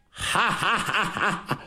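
soft, breathy hearty ha-ha laugh; scornful laugh. Acted. Old general laughs at the marshal's threats, scornful ha-ha.

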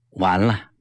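low, deflated sheepish admission. A narrator's reading. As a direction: concede awkwardly, pitch dropped. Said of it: "讪讪道". (讪讪地说)

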